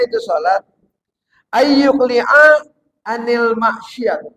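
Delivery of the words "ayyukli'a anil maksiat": slow Arabic recitation. Real, not acted. Quoting an Arabic text.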